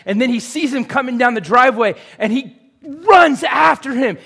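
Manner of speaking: rising passion. Real, not acted. Preacher telling how the father runs, shouting. Preacher building prodigal son story.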